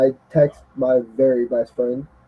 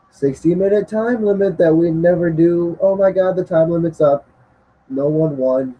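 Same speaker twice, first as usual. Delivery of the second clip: higher, overexcited commentator gasp; mock surprise; 6 semitones higher than usual. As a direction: gasp like a shocked announcer.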